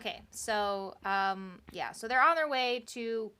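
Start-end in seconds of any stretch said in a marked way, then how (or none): none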